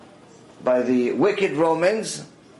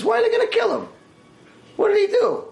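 higher, lightly voiced Roman woman asking; a hint of a curious noblewoman, pitched up; Roman woman's voice. Roman woman asks about the arrest.

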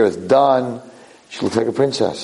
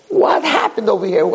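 shocked crowd; 10 semitones higher than usual. Retold or imitated. Shocked castle crowd reacts.

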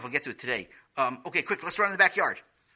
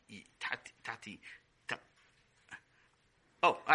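ordinary talk

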